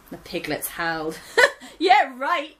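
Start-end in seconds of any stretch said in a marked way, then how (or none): 1.2-1.7 high, loud mocking laugh burst; piglets' shrill laugh. Piglets laughing at Gran's claim, high and loud. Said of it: "they laughed". 1.8-2.6 laughing mockery. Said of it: "they laughed".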